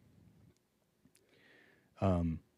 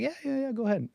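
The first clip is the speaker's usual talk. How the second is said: high, soft casual yes; breezy high-voiced clerk waving him through; mock clerk; 10 semitones higher, 6 dB quieter, faster than usual. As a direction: gas station guy letting him take ice, high and breezy. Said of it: "is the gas station guy".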